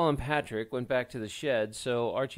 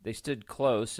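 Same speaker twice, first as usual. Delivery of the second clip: ordinary delivery, nothing marked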